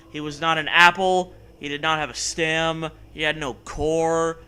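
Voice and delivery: normal talking voice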